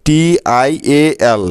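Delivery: টিআইএএল spelled very slowly letter by letter. Shown as a demonstration. Teacher drilling the spelling for students, very slow letter by letter. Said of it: "যেখানেই দেখবে".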